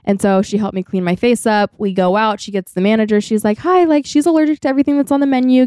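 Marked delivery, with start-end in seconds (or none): 3.6-5.7 high, quick voice of a helpful friend; imitated polite request. Friend speaking up to the restaurant manager for her, imitated polite request. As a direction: pitch it up and rattle it off politely.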